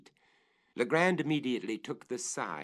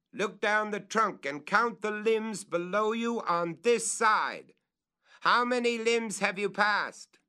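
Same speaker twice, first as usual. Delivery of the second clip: much higher, louder questions; calling up; 12 semitones higher, 7 dB louder than usual. Acted. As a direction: make him count the limbs.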